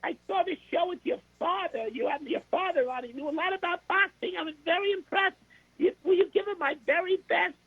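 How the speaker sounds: high, polite boxer impression; Mike Tyson. Boxer praising the reporter's show with his father, high-voiced polite impression. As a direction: pay compliments in a very high boxer voice. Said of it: "He says".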